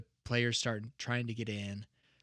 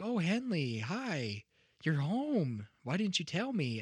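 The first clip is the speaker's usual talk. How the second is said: higher, lilting imitation of a delighted mother; happy mom greeting; 7 semitones higher than usual. Mom greeting her daughter home, mock delighted. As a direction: play a mom thrilled at an unexpected homecoming. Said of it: "mom was gonna be happy".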